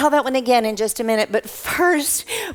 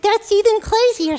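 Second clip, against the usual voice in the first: high-pitched puppet astonishment; squeaky puppet exclamation; amazed puppet; 11 semitones higher than usual. Acted. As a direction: react like an amazed little kid, high up. Puppet reacts to huge crowd.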